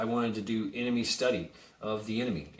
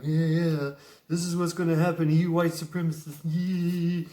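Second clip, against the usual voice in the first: higher, a bit louder, fast mocking gloat; gloating mob; 7 semitones higher, 4 dB louder than usual. Protesters jeering at beaten man, fast high gloating. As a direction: crow over him like a jeering crowd. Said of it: "they got the video camera on him".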